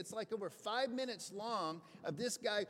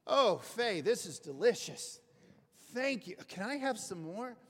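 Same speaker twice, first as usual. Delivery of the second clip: louder, overjoyed praise of the food; delighted diner; 6 dB louder than usual. Retold or imitated. Recovering man thanks his psychiatrist's wife for dinner, gushing. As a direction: gush over every bite like it's a feast. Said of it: "he's going".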